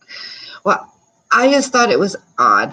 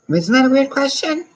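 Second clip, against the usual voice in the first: incredulous; 11 semitones higher, 3 dB louder than usual. Real, not acted. Reacting to a survey question.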